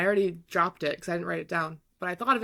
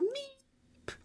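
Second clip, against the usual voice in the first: very high, soft drawn-out meep; thin high-pitched mock cat cry; Rufus; 8 dB quieter than usual. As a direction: imitate a cat's tiny squeak, held long. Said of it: "screams from the basement".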